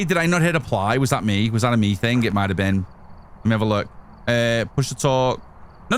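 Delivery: ordinary talk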